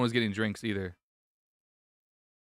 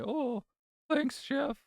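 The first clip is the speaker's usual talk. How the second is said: put-on high sweet old lady voice; grandma voice; much slower than usual. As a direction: thank the chef like a sweet grandma. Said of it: "Why did you sound like a grandmother".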